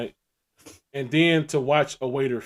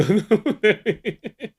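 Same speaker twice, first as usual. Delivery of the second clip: laughing; 10 semitones higher, 9 dB louder, much slower than usual. Real, not acted.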